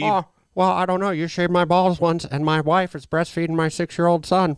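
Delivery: high, lightly voiced dopey guy; hammed-up impression of a confused husband; mock Jay Baruchel. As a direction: play him high and clueless. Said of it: "Oh, I'm Jay Baruchel".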